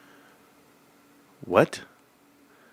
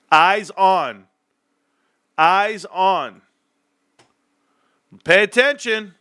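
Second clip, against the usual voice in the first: raised command; 6 semitones higher, slower than usual. Real, not acted. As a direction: snap the viewers to attention. Commanding viewers' attention.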